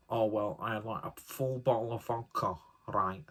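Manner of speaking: lightly put-on Mancunian accent, bragging teenager; mild Mancunian accent, imitating a teenager bragging about drinking; mock Mancunian teen. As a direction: take off a boastful Manchester schoolkid in a Mancunian accent. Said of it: "why this person's got a Mancunian accent".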